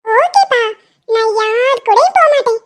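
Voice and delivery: a small daughter's obedient reply; little girl voice. Acted. Actress voicing a small daughter.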